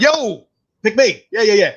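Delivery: high, excited retold plea; eager high holler, stretched yo; eager calling out. Retold jumping at ticket offer.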